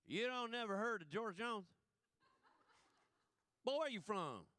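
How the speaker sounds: a Southern guy, amazed at the question, in full drawl. Retold or imitated. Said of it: "he said".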